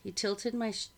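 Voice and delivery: plain reading voice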